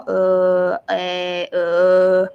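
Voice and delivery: low, held "uh" sounds; lowest range. Shown as a demonstration. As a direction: drop each "uh" to the bottom of your voice. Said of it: "the lowest range of your voice".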